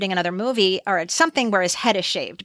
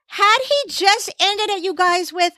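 higher, slowed emphatic aside; excited; 9 semitones higher, slower than usual. Real, not acted. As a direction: raise the pitch and lean in. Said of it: "excited aside".

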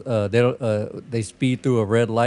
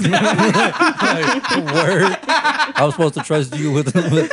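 high, loud incredulous riff through laughter; cracking up mid-bit; laughing hard; 7 semitones higher, 5 dB louder, much slower than usual. Real, not acted. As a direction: barely get it out for laughing. Cracking up while mocking film plot.

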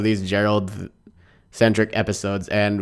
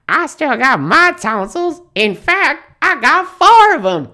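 Stinky voice; 6 dB louder than usual. Retold or imitated. Voicing Stinky in the episode.

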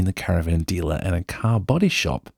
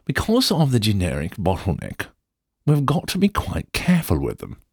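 put-on posh English accent; 3 semitones higher than usual. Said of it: "go full Downton Abbey".

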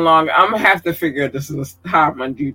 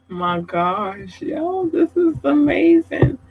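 overwhelmed amazement; 9 semitones higher than usual. Real, not acted. Overwhelmed by long list of fans.

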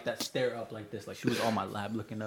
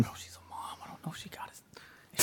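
hushed, breathy whisper to his friend; whispered aside; 11 dB quieter than usual. Retold or imitated. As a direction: whisper it so she can't hear.

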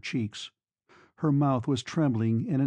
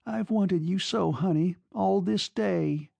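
longing; 6 semitones higher than usual. A narrator's reading. Girl greeting the lover she waited for, high and longing. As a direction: draw it out with tender longing.

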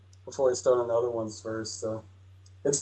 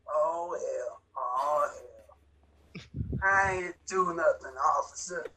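Vortigaunt voice; 5 dB quieter, slower than usual. Acted. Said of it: "I'll do the Vortigaunt voice".

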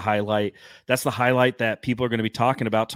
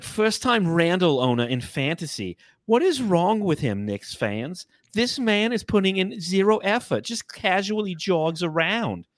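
put-on New York accent, higher voice; 4 semitones higher than usual. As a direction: rant like a New Yorker. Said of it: "so I'll go back to my".

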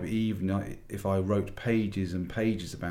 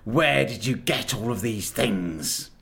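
much higher, louder contemptuous command; furious witch; 6 semitones higher, 6 dB louder than usual. A narrator's reading. Witch queen berates the silent animals, high and furious. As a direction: spit the words at them. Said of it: "she said again".

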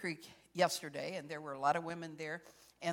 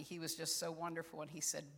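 ordinary delivery

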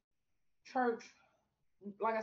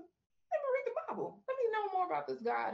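eager; 12 semitones higher than usual. Retold or imitated. Quoting her eager decision.

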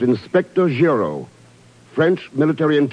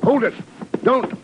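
urgent shout; 11 semitones higher than usual. Acted. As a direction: yell to stop him, high and sharp. Trying to stop the shooting.

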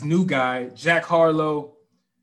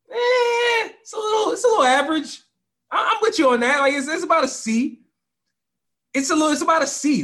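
high mock voice; 12 semitones higher, 3 dB louder than usual.

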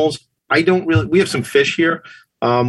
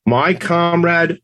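ordinary talk, nothing marked

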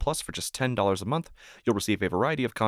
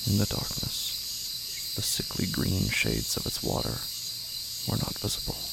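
slow, hushed, half-whispered dread; eerie horror narration; 6 dB quieter, slower than usual. A narrator's reading. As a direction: draw the listener into the dark pond, slowly. Eerie horror story narration opening.

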